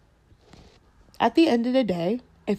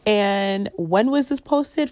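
usual speaking voice

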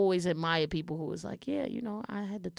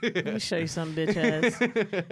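mock mom clapback. Acting out mom's clapback.

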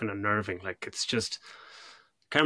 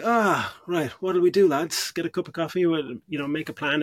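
high, louder, jokey casual crew chatter; mock relaxed crew; 6 semitones higher, 4 dB louder than usual. Crew member calling the lads to a coffee break, high and jokey. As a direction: ham up a crew taking a break. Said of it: "a close-up of Parker going".

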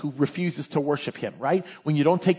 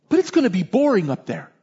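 put-on higher, protesting child voice; young child raising an objection; complaining little kid; 8 semitones higher, 4 dB louder than usual. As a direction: imitate a small boy's complaint. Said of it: "This is like five-year-old, six-year-old".

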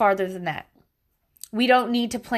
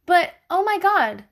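ordinary delivery

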